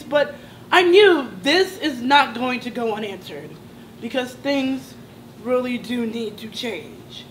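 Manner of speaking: ordinary delivery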